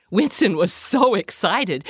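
usual speaking voice

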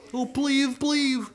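high, begging whimper; pleading bear. Acted. Freezing bear begging at the door, high pleading.

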